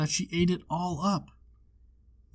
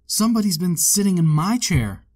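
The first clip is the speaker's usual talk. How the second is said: louder, a touch higher mama bear; lightly voiced mother bear, a bit louder; middle-sized bear voice; 4 semitones higher, 6 dB louder than usual. A narrator's reading. Mama bear finds her chair used, lightly voiced mother bear. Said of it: "in her middle-sized voice".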